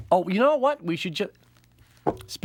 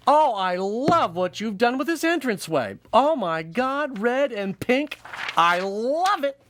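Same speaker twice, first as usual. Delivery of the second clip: high, slow, gushing; gushing lady voice; 8 semitones higher, 3 dB louder, slower than usual. Acted. Female sperm admiring the entranceway, high gushing. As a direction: coo over the decor. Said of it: "admire the decor".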